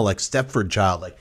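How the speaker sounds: ordinary talk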